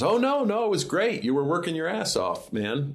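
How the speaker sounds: bright, high retold praise; upbeat Jim impression. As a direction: gush it like an enthusiastic boss. Jim's reply, voiced in the retelling.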